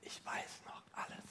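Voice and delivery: a faint whisper; whispered close to God. Acted. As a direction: breathe it out as a secret confession. End of the acted prayer.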